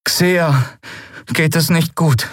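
put-on high, downcast young voice; heavy-hearted, high-pitched delivery; deeply troubled. A narrator's reading. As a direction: break the bad news, high and troubled. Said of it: "Miko klang tief bedrückt".

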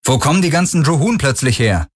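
high, called question; calling out. A narrator's reading. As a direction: fire the question across to him. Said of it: "rief Galen".

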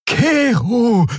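high, desperate calling of a name; desperate cry. A narrator's reading. Nelen calling out to stop Keru, desperate cry. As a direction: call out to him in despair, high. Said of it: "rief Nelen verzweifelt aus".

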